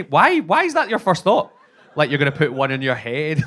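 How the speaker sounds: high, loud, incredulous outburst; loud disbelief. Acted. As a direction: demand it, pitch leaping up in disbelief. Outburst at the man's first thought.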